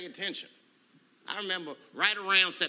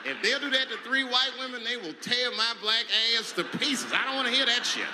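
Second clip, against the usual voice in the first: louder, pushing each line; raised voice, slowed on the punchline; loud comic rant; 6 semitones higher, 5 dB louder than usual. Real, not acted. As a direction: get worked up about it. Ranting, louder.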